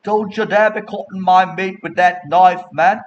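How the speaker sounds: acted outrage at a tableside affront; indignant customer. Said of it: "and somebody else out".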